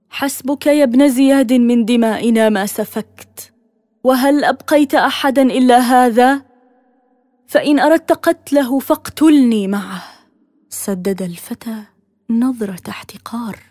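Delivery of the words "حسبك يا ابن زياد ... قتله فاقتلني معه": loud and higher, fierce defiance; angry protest. A narrator's reading. Zainab defying the governor, shouting in anger. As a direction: confront him with fury. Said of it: "اعترضت زينب بغضب".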